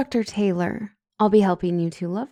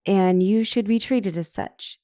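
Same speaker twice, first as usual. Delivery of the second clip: ordinary delivery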